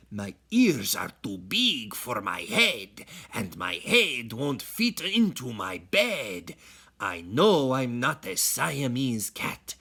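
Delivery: a hint of Spanish accent, proud at the end. Skippy pretending to be a Chihuahua in a light Spanish accent. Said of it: "using his very best Spanish accent".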